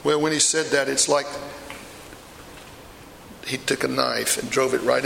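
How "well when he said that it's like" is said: ordinary talk, nothing marked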